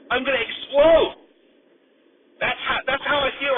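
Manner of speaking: high, loud giddy outburst; bursting with manic energy; wild unhinged ranting. Real, not acted. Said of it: "I'm just talking like a freaking lunatic".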